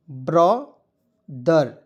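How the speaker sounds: Brother drawn out very slowly, syllable by syllable; slow demo word. Shown as a demonstration. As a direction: stretch out brother slowly for the class. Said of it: "को पढ़ेंगे दोस्तों brother". (Brother को बहुत धीरे बोलकर दिखाएँ)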